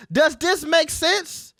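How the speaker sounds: high, slow laughing question; laughing mock. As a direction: still in the mocking voice, laughing. Mocking her, laughing.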